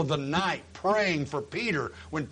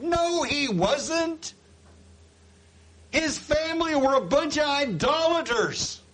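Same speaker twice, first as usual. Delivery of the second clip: high, emphatic pulpit rebuttal; worked-up preaching; 10 semitones higher, 4 dB louder than usual. Acted. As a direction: contradict forcefully in a raised voice.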